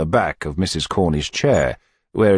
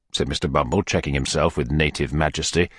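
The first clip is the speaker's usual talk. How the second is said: plain reading voice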